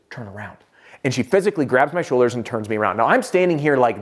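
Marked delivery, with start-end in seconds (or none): 0.1-0.6 very soft, low imitated whisper; hushed, discreet instruction. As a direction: whisper it secretively into someone's ear. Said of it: "leans and whispers in my ear".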